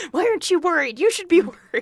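high, loud hammed-up worry; mock alarm. Co-host joining the joke about panicking officials, high mock alarm. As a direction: push it way up into comic panic.

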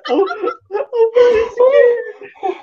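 high, slow laughing yell; laughing shout. Real, not acted. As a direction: howl it through laughter.

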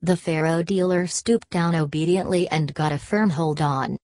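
plain reading voice

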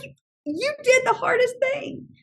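acted-out gushing praise; delighted praise. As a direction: marvel at a child's achievement. Retold praise of child.

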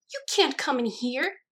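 high, loud, drawn-out outrage; slow, high, scandalized exclamation; scandalized cry. A narrator's reading. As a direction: cry out in shocked protest. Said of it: "she cried, scandalized".

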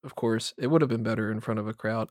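dull, level, subdued reading voice; mock monotone podcast host. Shown as a demonstration. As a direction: read your notes with no inflection. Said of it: "somebody's got a monotone voice".

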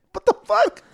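high-pitched baffled outburst; retold squeal of confusion; mock bewilderment. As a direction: act out total disbelief, voice shooting up. Acted baffled reaction to friend cleaning.

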